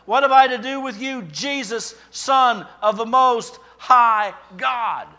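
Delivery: high, loud crying out, slowing on the last phrase; a loud, high demonic outcry. Acted. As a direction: cry it out like a man possessed. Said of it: "he cried out with a loud voice".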